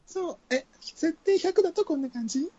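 high female voice. Shown as a demonstration. Trying out a high female voice for the listeners. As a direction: try a high feminine voice at full setting.

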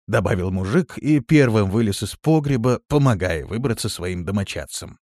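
ordinary delivery

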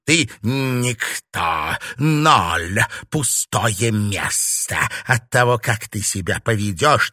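put-on stern, imperious old witch; villainous mentor asserting total power; domineering witch mistress. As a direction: lay down the law to a new novice, stressing each insult. Villain lays down rules.

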